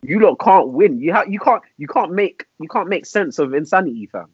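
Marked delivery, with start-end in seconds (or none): none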